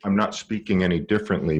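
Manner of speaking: usual speaking voice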